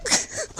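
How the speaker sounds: a high breathy sob catching in the throat; choked sob. Real, not acted. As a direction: break into a sob mid-lament. Sob between lament lines.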